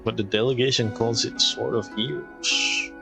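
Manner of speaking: normal talking voice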